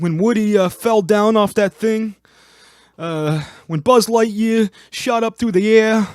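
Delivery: very high, slow and loud gushing; high, slow excited imitation; gushing fan voice. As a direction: rave like an overjoyed moviegoer. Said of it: "if he had come in and be like".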